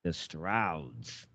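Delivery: "The Strouds" dropped low and soft; deep mock voice. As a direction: growl the name low and quiet.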